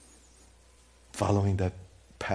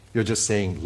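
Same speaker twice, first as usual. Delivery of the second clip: ordinary delivery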